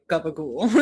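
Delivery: gabagool for capicola, ending in "ool"; amused gabagool, final "la" cut to "l"; family's Italian-American pronunciation. Retold or imitated. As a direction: say gabagool, voiced g and b, through a laugh. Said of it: "my family says".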